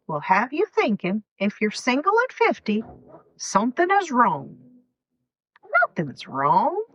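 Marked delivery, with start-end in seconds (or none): none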